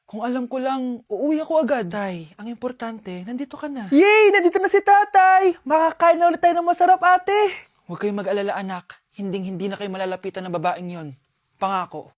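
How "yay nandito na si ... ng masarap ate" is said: put-on loud, happy kid's cheer; excited cheer. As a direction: celebrate that dad is home. Kids cheer as father returns.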